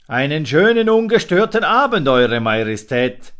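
loud, high, fawning fox; fox's greeting. A narrator's reading. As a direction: flatter the king with sly charm. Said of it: "grüßte er".

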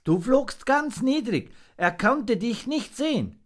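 very high creature voice; little monkey, admiring. A narrator's reading. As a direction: praise in a tiny high voice.

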